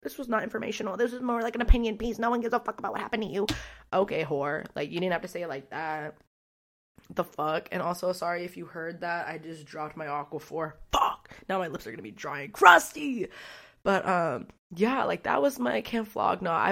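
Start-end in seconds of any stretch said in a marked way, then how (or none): 0.0-3.5 snide critic voice; a hint of a snooty hater; mocking critic. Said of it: "And you might think". 10.9-13.3 loud frustrated yelp; louder mock-dramatic complaint; annoyed outburst. Real, not acted. Dropped her lip balm.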